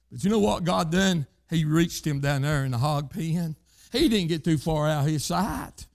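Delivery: ordinary talk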